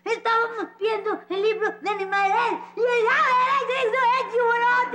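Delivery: bawling while trying to explain; hammed-up tearful tattling; crying. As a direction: wail the words through childish sobs, rising at the end. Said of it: "¿Por qué estás llorando?".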